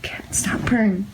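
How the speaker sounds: whispered under breath; hushed whisper. Real, not acted. Quiet ghost hunt in room.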